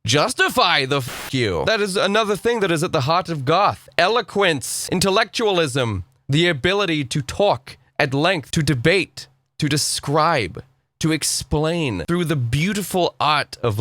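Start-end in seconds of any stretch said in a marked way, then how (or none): none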